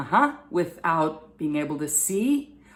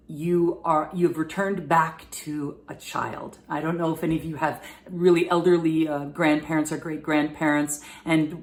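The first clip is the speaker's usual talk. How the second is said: usual speaking voice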